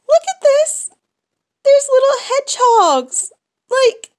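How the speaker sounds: high, loud squeal of delight; excited squeal. Real, not acted. Showing the little hedgehogs to viewers, squealing loud and high.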